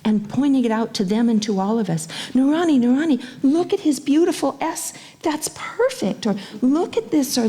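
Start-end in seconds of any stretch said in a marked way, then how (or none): 2.3-6.2 put-on higher, eager child calling a friend; a hint of a delighted kid pointing out; excited child. Child praising classmate's writing. 6.6-7.4 put-on higher, eager child calling a friend; a hint of a delighted kid pointing out; excited child. Child praising classmate's writing.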